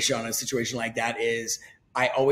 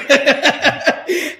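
loud high laugh; 10 dB louder than usual. Real, not acted. Laughing at his own lawn brag, loud laugh.

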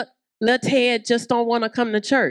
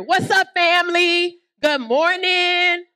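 calling out greeting; 7 semitones higher, slower than usual. Acted. Pastor greeting the church across the foyer. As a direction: call it across the whole foyer.

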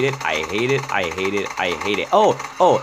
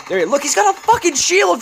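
much higher, louder exasperated yell; frustrated yell; 4 dB louder than usual. Real, not acted. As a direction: yell in disbelief at the shielded zombie. Frustrated gamer yelling at the zombie.

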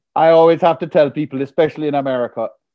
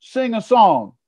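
higher, brash American heckler voice; doubting heckler; 6 semitones higher than usual. Retold or imitated. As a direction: taunt him to prove himself. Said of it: "a man jumps up and says".

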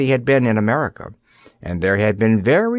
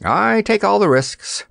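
complacent; 9 semitones higher than usual. A narrator's reading. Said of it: "he explained complacently".

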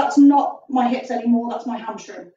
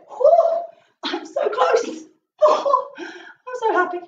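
high, slow, breathless delight with a laugh; strained joyful gasp; 9 semitones higher, much slower than usual. Real, not acted. Instructor reaching the floor in a split, high breathless delight. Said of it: "I'm gonna cry, but I'm happy".

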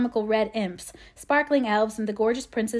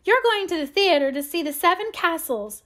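high-pitched, triumphant outburst; much higher, excited child's exclamation; excited cry; 8 semitones higher, 4 dB louder than usual. A narrator's reading. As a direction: cry it out as if the secret is found. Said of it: "she cried".

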